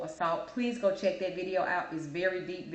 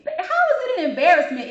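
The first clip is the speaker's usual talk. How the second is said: higher and louder disbelief; incredulous; 9 semitones higher, 9 dB louder than usual. Real, not acted. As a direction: throw the question back, pitched up and louder. Incredulous rant at customers.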